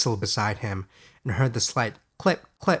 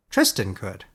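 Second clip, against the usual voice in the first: plain reading voice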